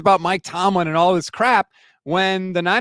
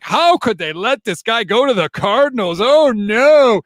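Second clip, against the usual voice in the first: mock panicked fans; 6 semitones higher, 5 dB louder than usual. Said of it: "it was like".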